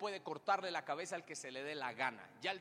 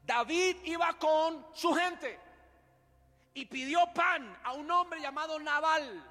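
very slow, high, loud storytelling; dramatic narration; 12 semitones higher, 10 dB louder, much slower than usual. A narrator's reading. Pastor narrating David's past to the church, dramatically. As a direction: draw the congregation into the story.

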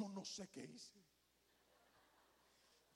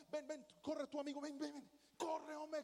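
higher, louder yell after the fleeing thief; mock calling out; 7 semitones higher, 3 dB louder than usual. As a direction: shout at him to come back.

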